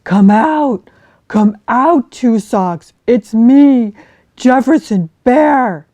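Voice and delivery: loud calls through the woods; calling out. Acted. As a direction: call out to a lost friend.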